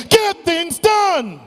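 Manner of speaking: slow, high rallying shout; shout with conviction. Shown as a demonstration. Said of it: "Say this with conviction".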